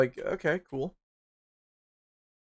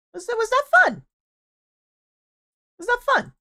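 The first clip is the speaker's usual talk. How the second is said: much higher and louder, mock-cheery; sarcastic squeal; 9 dB louder than usual. Real, not acted. Asking viewers about being blamed, a much higher sarcastic squeal.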